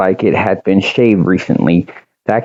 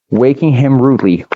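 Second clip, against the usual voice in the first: usual speaking voice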